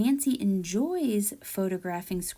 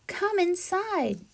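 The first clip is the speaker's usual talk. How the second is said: squirrel voice; 10 semitones higher, slower than usual. Acted. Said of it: "says Cousin Squirrel".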